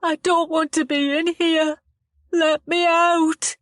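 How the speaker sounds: high, slow tearful begging; frightened boy begging to be let out, tearful and high; tearful pleading. A narrator's reading. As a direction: plead on the edge of tears. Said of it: "tears welling in his eyes".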